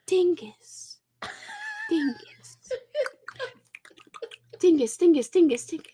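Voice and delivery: soft, high, drawn-out trigger word; lightly whispered ASMR trigger; a touch of ASMR whisper; slow mock whisper. Shown as a demonstration. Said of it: "If I slowly whispered".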